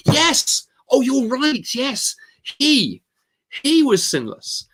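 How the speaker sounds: high, drawn-out exclamations of delight; jubilant agreement. Retold or imitated. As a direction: act out delight at hearing the right answer. Friend celebrates the answer he wanted.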